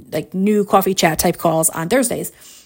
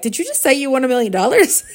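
fast, higher-pitched question breaking into laughter; imitated astonishment; 4 semitones higher, faster than usual. Co-host's husband overhearing the win, quick laughing astonishment. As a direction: blurt it quickly, amused and amazed.

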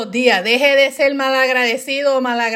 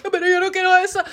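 put-on high, tearful child's whine; hammed-up whimpering protest; mock crying; 7 semitones higher, much faster than usual. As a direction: imitate yourself sobbing as a kid. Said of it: "Y yo llorando".

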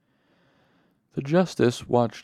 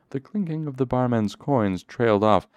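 ordinary delivery, nothing marked

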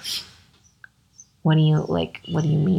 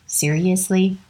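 normal talking voice

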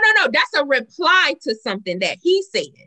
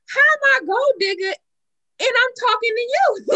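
indignant retort; 11 semitones higher than usual. Retold or imitated. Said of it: "I'm like".

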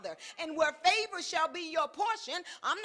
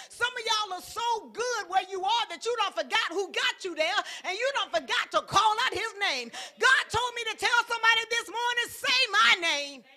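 loud, fervent sermon climax; full-force preaching; 4 semitones higher, 6 dB louder than usual. Acted. Preacher drives the congregation to call God's name, shouting. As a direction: preach it with fire to the whole room.

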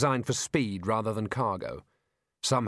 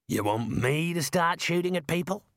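higher question, rising to the end; incredulous retort; 5 semitones higher than usual. Acted. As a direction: throw it back with stress on "me". Driver throws the question back.

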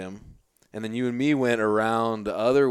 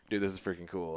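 laughing; 3 semitones lower, 9 dB quieter than usual. Retold or imitated. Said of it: "I was like".

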